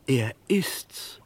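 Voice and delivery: thrilled disbelief as the penny drops; excited recognition. Acted. Gloster tells Henry that the corpse is the great Stetson, excited and loud.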